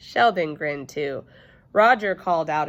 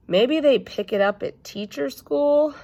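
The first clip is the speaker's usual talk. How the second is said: put-on wry child remark; 9 semitones higher, 5 dB louder than usual. Junie B sarcastic remark.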